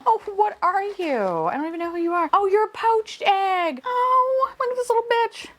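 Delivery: high squeaky cooing; excited squeal. Real, not acted. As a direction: squeal over something cute. Surprise reveal, squealing.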